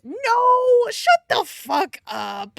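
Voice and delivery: loud, high disbelieving yell; outraged shout. Real, not acted. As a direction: shout at the book in protest. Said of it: "reacting to plot".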